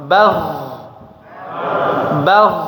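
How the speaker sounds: "Bagh" ends with a light flowing buzz; flowing sukun. Shown as a demonstration. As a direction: let the final dhad ring through. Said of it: "suara yang mengalir". ("Bagh" suaranya mengalir)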